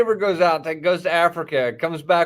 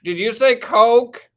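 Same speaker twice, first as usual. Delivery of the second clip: louder, slower, higher drive-thru worker; 7 semitones higher, 8 dB louder, slower than usual. Retold or imitated. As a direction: call it out slowly over a drive-thru speaker. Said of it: "totally different person that comes on".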